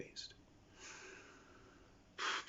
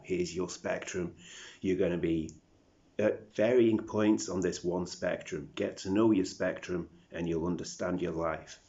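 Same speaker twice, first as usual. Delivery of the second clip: loud, emphatic announcement of rules; mock instructor; 7 dB louder than usual. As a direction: lay down the rules loudly, like an authority. Acting the world handing out instructions.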